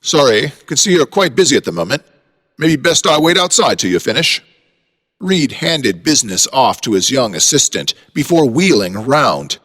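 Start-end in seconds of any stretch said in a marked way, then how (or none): 0.0-4.4 put-on British accent. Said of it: "said a British accent".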